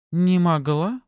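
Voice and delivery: high-pitched, mocking question; scornful echo. A narrator's reading. Pafi mocking her rival's disbelief, contemptuous. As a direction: throw the words back as a scornful question. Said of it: "Пафи презрительно рассмеялась".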